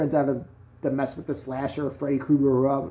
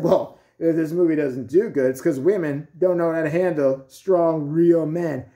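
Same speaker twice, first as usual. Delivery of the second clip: louder, slowing macho man voice; imitation of a man making a pompous statement; mock sexist man; 5 dB louder, slower than usual. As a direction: put on a blustering man's voice, loud. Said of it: "if a man said".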